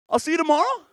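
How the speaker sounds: high mock woman voice; Delilah. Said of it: "This is Delilah's voice".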